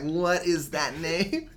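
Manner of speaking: high, loud, drawn-out disbelief; incredulous. Retold or imitated. Host reacting to an odd character name, incredulous. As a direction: stretch it out in baffled, mock disbelief.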